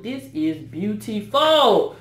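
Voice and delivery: slow, savoured praise; drawn-out awe. Real, not acted. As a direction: stretch it out in wonder. Drawn out admiration.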